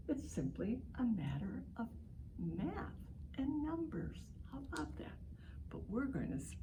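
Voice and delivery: put-on hushed, conspiratorial aside to the kids; mysterious whisper. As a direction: whisper it like a little secret. Teacher building mystery for children.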